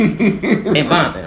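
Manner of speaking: chuckles the words out slowly; laughing. Real, not acted. Laughing about gas stations he never entered.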